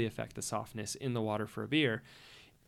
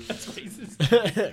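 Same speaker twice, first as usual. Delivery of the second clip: mock outrage; 10 semitones higher, 8 dB louder, much slower than usual. Joking banter about water.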